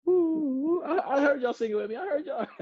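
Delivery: laughing high tease; playful laughing ooh. Real, not acted. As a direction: tease the crowd through laughter. Teasing audience about singing.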